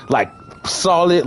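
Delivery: usual speaking voice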